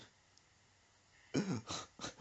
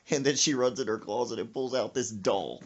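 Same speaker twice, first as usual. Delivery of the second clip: ordinary delivery, nothing marked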